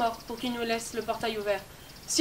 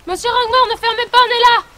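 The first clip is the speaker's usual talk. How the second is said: shouted calls, growing higher and louder each time; calling out, rising panic; 9 semitones higher, 12 dB louder than usual. Acted. Locked in the cemetery, shouting to the caretaker in rising panic. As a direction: yell across the graveyard as the gate shuts.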